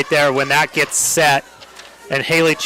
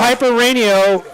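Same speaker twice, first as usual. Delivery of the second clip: excited call; 7 semitones higher, slower than usual. Real, not acted. Excited call after rally.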